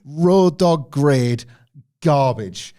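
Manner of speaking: road dog grade drawn out slow and loud; slow emphatic disgust. Real, not acted.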